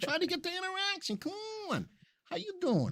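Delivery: hammed-up begging for chat; mock pleading push. Host begging stream viewers to chat, in squeaky mock pleading. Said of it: "You see, I'm pushing".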